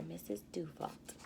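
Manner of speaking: ordinary delivery, nothing marked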